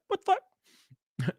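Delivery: very high, soft, drawn-out disbelief; retold bafflement. To a friend who took the joke seriously, mock bafflement. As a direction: squeak it slowly in mock bafflement.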